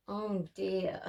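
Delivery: ordinary delivery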